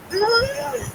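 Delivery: tiny high-pitched whimper; soft, strained squeal through closed lips; Dobby. Retold or imitated. House-elf unable to answer Harry, quiet high whimper.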